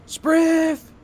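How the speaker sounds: very high, loud repeated call; second yell to the back room; yelling again. Acted. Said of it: "turns over her shoulder and yells".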